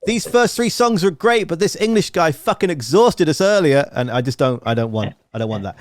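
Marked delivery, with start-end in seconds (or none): 0.1-3.9 high-pitched mock announcement to a crowd; imagined performer complaining. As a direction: put on a higher voice, grumbling from the stage. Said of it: "you walking out and going".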